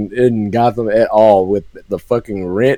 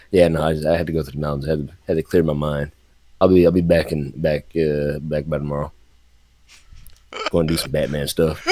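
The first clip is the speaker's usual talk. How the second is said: low, soft, offhand Batman, lower and quieter; a hint of Batman, lower and calm; Batman unbothered; 8 semitones lower, 6 dB quieter, faster than usual. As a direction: shrug it off as if nothing happened. Batman's casual excuse.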